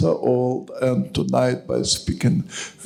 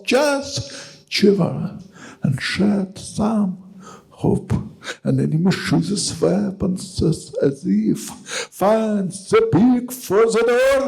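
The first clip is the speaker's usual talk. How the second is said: measured, slow poem recital with long pauses; poetry reading; 7 semitones higher, slower than usual. Acted. Poet reading his translation to the evening audience, solemn recital. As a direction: read the poem to the audience, weighty and deliberate.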